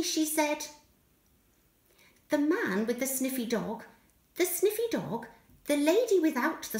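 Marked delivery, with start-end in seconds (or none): none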